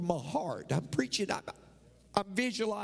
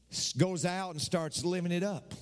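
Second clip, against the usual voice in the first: ordinary delivery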